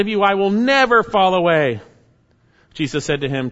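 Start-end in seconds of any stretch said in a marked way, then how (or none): 0.0-1.8 loud, high insistent boast; a defiant, loud promise; bold vow. Acted. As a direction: declare it proudly for all to hear. Peter's boast, quoted.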